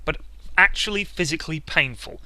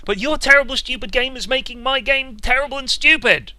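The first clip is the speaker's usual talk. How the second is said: louder and higher angry retort; exasperated outburst; 7 semitones higher, 4 dB louder than usual. Acted. Snapping back at a stubborn teammate in exasperation. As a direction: fire it back at them, fed up.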